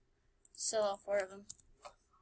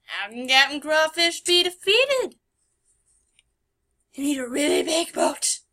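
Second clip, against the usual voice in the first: ordinary talk, nothing marked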